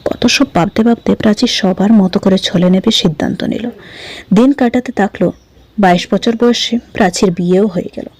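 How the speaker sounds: ordinary delivery, nothing marked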